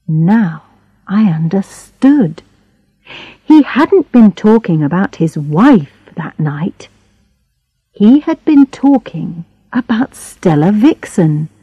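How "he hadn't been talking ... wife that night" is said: usual speaking voice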